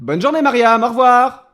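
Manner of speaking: loud dismissal. Acted. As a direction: shout a hurried goodbye through the shutter. Dismissing visitor through shutter.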